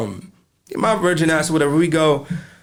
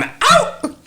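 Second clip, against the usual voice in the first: mock yelp; 4 dB louder than usual. Friend mimics the brother's reaction.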